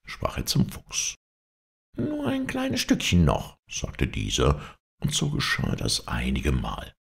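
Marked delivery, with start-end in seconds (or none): 2.0-3.6 very high, thin fox voice; high-pitched pleading fox; wheedling fox. A narrator's reading. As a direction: beg a little favour in falsetto. Fox wheedles the tired wolf.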